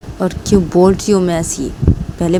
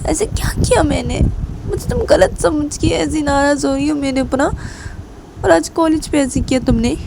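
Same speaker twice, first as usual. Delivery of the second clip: much higher, insistent pleading voice; Reshma pleading; 8 semitones higher than usual. Acted. Teacher pressing silent Kajal why she is angry, high pleading voice. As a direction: keep pressing her with rising, anxious questions. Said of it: "तो तभी रेशमा काजल बोलती".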